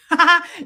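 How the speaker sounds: high, loud laugh; burst of laughter. Real, not acted. Host greeting a chat member, bursting into laughter.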